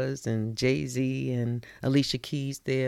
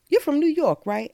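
mock questioner; 10 semitones higher, 5 dB louder than usual. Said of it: "People would ask me".

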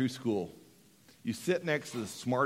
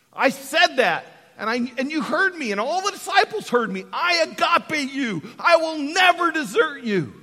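louder, higher preached confession; preaching at full force; 11 semitones higher, 7 dB louder than usual. Acted. Peter recalling his vow to Jesus, loud preaching. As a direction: build up Peter's boast with rising force.

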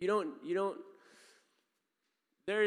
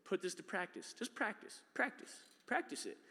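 Jesus coaching; 6 semitones higher, 4 dB quieter than usual. Retold or imitated. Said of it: "He says".

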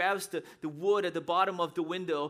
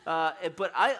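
normal talking voice

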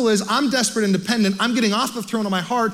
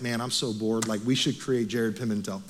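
a lightly voiced bored God, low and soft, a sigh of idle boredom; mock bored God; 9 semitones lower, 7 dB quieter than usual. Pastor jokes God was bored, mock bored and softer.

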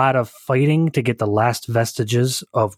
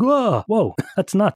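surprised; 9 semitones higher, slower than usual. Retold or imitated. Stunned reaction to the news.

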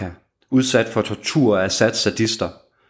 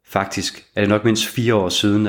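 usual speaking voice

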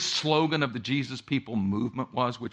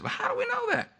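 high, softer questioning voice; a doubtful disciple pushing back; doubting Thomas; 4 dB quieter, faster than usual. Retold or imitated. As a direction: skeptical Thomas, pitched up. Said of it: "Thomas demurs".

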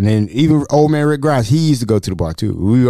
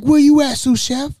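wife yelling; 13 semitones higher than usual. Retold or imitated. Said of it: "She's like".

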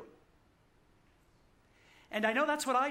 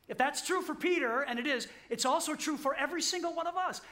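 rising preaching; 7 semitones higher, faster than usual. Real, not acted. Preacher building to climax.

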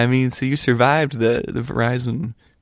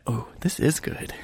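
hushed self-quote; 9 dB quieter, slower than usual. Retold or imitated. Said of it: "I'm like".

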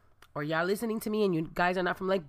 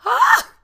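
high, loud mock gasp; sudden loud gasp, acting out a fright; loud startled gasp; 14 dB louder than usual. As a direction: imitate a shocked, sharp gasp. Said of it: "I hear her go".

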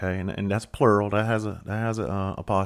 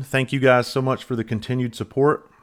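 ordinary delivery

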